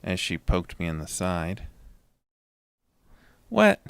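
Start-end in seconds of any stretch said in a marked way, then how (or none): 3.5-3.8 sharp, high, loud yelp of surprise; startled denial. A narrator's reading. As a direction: jump up high as if caught.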